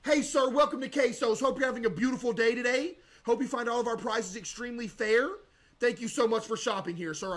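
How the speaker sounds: over-friendly store clerk; cheery shopkeeper voice. Acted. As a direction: greet the customer like an eager cashier. Greets customers as a shopkeeper.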